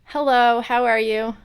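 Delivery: ordinary talk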